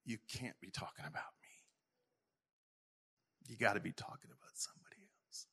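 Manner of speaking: soft, doubting whisper. Retold or imitated. Pastor recalling his doubt toward God, whispering. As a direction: breathe it out in disbelief. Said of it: "it caused me to go".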